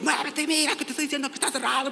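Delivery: half-hearted imitation of a driver shouting; retold shouting. Other driver yelling at the woman to move, mild mock yell. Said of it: "lo empezó a hacer a gritos".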